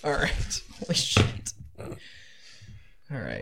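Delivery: breathless whispered disbelief; stunned whisper. Real, not acted. DM murmurs to himself after a huge roll, whispering. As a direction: sink into a shocked whisper.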